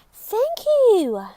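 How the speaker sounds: high, loud cheerful thank you; bright loud thanks. Acted. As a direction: call out a happy thank you. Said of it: "said the woman".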